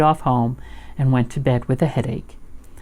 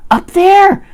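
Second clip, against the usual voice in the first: high, loud frightened reply; small scared creature calling out; Piglet; 9 dB louder than usual. A narrator's reading. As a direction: squeal the answer in alarm. Frightened Piglet points out monster.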